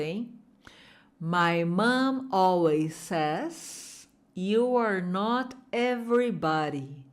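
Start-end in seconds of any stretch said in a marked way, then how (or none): 1.2-7.0 the whole sentence slow and clear; slow full sentence. Shown as a demonstration. Teacher modelling the full sentence slowly aloud. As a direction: model it slowly for students to repeat. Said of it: "falando em voz alta".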